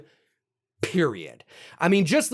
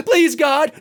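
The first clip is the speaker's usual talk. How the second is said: mock pleading; 8 semitones higher, 5 dB louder than usual. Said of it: "she's like".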